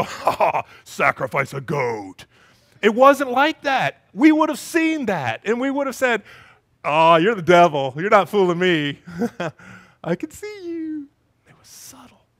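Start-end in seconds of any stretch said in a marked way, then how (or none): 0.0-2.3 a hint of a cartoon devil, slow; mock devil. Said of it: "the devil didn't show up in a big red suit with horns". 6.8-11.1 louder, calling the devil out; mock defiance. Acted confrontation with obvious devil. 11.5-12.3 breathy secret whisper. Acted. Preacher drops to a whisper.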